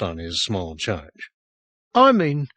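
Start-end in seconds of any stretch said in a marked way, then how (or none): 1.9-2.5 put-on higher, louder, impatient child; exasperated boy. A narrator's reading. Boy explains to the slow sergeant, higher and exasperated. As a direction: spell it out, fed up.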